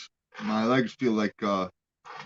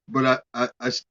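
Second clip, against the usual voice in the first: ordinary delivery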